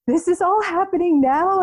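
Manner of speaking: high, startled panic; high panicked disbelief. Retold or imitated. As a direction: squeak out the shock of it happening now. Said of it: "I'm like".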